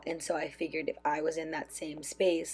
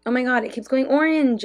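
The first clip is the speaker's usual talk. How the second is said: exasperated; 5 semitones higher, 6 dB louder than usual. Real, not acted. Mic light keeps going orange.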